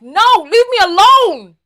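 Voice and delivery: high, loud mock yell; loud pleading refusal. Dieter to the treat in the cabinet, loud protest.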